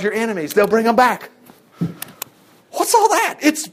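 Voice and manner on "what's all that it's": marvelling. Real, not acted. Marvelling at blessings.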